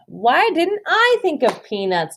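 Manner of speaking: put-on a girl annoyed she missed the idea; wondering girl voice. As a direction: sound like a kid kicking herself.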